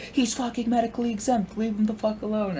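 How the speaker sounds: high-pitched mock yell from afar; retold yelling. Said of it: "still starts yelling at me".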